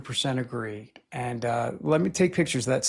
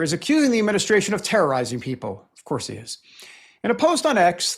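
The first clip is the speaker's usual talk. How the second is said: ordinary talk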